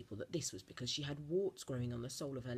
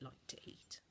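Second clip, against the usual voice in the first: much softer, breathy whisper; faded whisper; 12 dB quieter than usual. A narrator's reading. Narrator finishing the list of revolting foods, hushed. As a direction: let it die away to a whisper.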